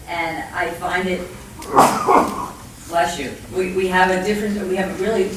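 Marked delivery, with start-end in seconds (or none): none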